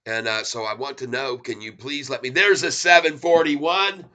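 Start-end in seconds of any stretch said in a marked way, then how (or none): 2.3-4.0 up high and loud, thrilled; excited cheer. Real, not acted. Streamer to viewers, thrilled as the price jumps. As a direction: celebrate the price jump. Price hits his target.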